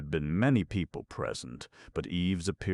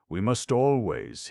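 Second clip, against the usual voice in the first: beaming, higher and louder; cheerful; 6 semitones higher, 5 dB louder than usual. Acted. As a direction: say it with a dazzling smile. Smith cheerful, dazzling smile.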